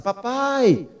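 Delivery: acted high-pitched yelling; imitated child's cries. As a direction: cry out for Dad like a hungry daughter. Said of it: "os gritos dela".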